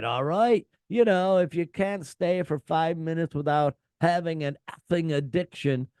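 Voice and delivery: hammed-up scolding of the crowd from the stage; imitating an annoyed singer telling off fans. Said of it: "[name] can be heard saying".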